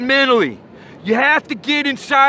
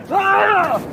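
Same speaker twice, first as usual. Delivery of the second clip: high yelp of panic, hammed up; mock scream; 7 semitones higher than usual. Surfer acting out a wipeout for the audience, hammed-up high yelp. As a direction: yelp like you're tumbling in a wave. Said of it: "floating back around like this".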